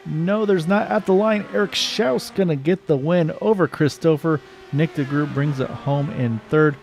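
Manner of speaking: excited finish call; play-by-play. Acted. Calling the finish live.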